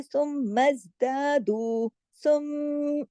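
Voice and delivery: सुम with a long hum on म; slow recitation, hold सुम in the nose; held nasal M. Shown as a demonstration. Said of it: "इधर गुन्ना करके". (सुम पर गुन्ना करके)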